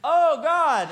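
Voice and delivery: high, loud startled cry; shocked yelp at the handshake; alarmed. Retold or imitated. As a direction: jump up in alarmed surprise. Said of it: "And I go".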